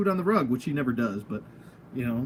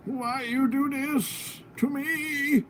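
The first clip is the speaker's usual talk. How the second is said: put-on old man, pitched higher and softer; playful higher old man voice for the angry toy head; 11 semitones higher, 3 dB quieter, much slower than usual. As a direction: whine it like a wounded old man. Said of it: "I'm doing old man voice right now".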